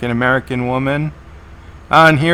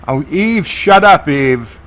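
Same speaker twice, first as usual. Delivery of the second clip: exasperated, voice jumping up; exasperated scolding. Real, not acted. Viewer scolds a character on screen.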